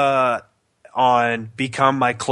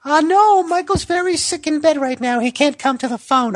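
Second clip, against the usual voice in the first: high British mom falsetto. Retold or imitated. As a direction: play mom covering for her sick son. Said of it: "I answered as my mom".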